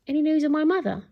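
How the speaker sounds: high, bright-eyed eager question. A narrator's reading. Boy asks Nanny for news of mother, eager. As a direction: ask hopefully, voice lifted. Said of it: "he asked eagerly".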